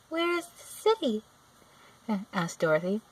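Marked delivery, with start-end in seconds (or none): 0.1-1.2 put-on higher curious girl; young girl voice. A narrator's reading. Dorothy asks the witch where the city is, high and eager. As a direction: ask it eagerly like a child.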